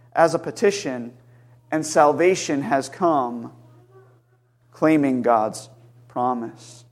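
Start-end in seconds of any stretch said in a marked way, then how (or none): none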